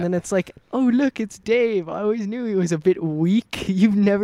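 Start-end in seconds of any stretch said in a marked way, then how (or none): 0.7-3.6 mock British TikTok voice, starting high; British accent. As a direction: put on a British dude's voice. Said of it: "in his British accent".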